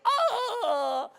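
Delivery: high laughing shriek; mock scream. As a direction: scream it while half laughing. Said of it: "while he was screaming".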